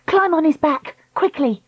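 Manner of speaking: high, pressing command; urgent. Acted. Dakota getting Treacle onto the turtle, urgently. Dakota urging escape from water.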